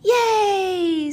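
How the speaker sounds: loud, high, drawn-out cheer; group cheer. Acted. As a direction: cheer like a bunch of happy kids. Friends cheer the new game.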